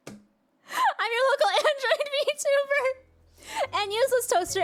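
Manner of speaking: high, slow cute intro; sing-song cute streamer intro; cute anime streamer. Shown as a demonstration.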